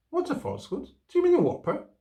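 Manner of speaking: put-on high, puzzled child's voice asking innocently; a little boy who truly doesn't know the word. A narrator's reading.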